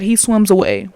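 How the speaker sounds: loud disbelief. Real, not acted. Repeating it to viewers after "did you hear that?", loud disbelief. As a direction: hammer the line home in disbelief.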